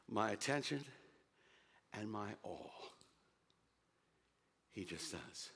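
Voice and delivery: fading to a soft, low hush; tender devotion. Real, not acted. Preacher confessing devotion, growing hushed. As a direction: let it sink into quiet awe.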